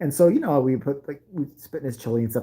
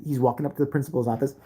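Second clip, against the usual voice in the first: ordinary delivery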